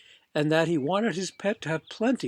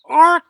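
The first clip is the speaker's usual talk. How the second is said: sharp high loud squawk; a startled bird cry; much higher, loud startled ark; penguin squawk; 13 semitones higher, 17 dB louder than usual. Acted. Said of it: "said the penguin, or perhaps it was the serviceman".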